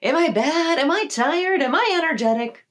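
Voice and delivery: confused self-questioning. Retold or imitated. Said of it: "you're like".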